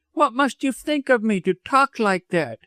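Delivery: high-pitched, affronted woman; indignant. A narrator's reading. Widow retreats from doctor's advances, indignant. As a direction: scold him in a high, offended voice. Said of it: "she cried angrily".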